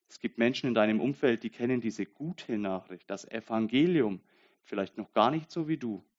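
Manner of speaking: normal talking voice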